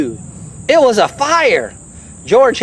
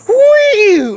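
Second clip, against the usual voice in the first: whee; 12 semitones higher, 3 dB louder than usual. Acted. Skunk spray sound effect.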